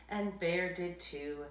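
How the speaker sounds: usual speaking voice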